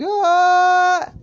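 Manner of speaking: shorter loud, piercing mock scream; high scream. Narrator retakes the scream they hear, high yell. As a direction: let out a high held yell. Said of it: "they hear a scream".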